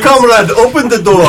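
a lightly laughing mock Russian accent; half-laughing fake Russian demand. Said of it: "I'm going Russian again".

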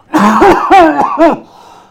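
big hacking cough, acted out; loud cough. God coughs in the prophet's face, loud acted cough. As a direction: cough loudly right at someone.

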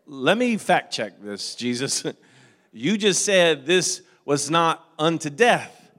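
mock fact-check. Jokingly questions Jesus aloud.

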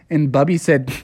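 ordinary delivery, nothing marked

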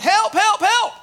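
high, mock yell for help; retold scream for help. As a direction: call out for help like a scared boy in a story. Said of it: "he immediately screamed out".

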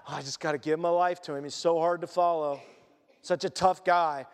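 preacher mocking a reluctant disciple, low and sulky; mock grudging complaint. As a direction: whine it like a reluctant follower.

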